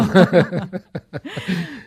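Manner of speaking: a loud burst of laughter; real laugh. Real, not acted. Host laughing at the guest's on-air confession, real laugh.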